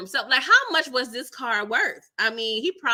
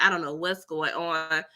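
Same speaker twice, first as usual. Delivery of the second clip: ordinary talk, nothing marked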